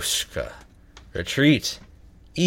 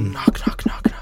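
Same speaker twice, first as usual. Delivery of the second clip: whispering; 4 semitones lower than usual. Pretend. Spooky haunted knocking whisper.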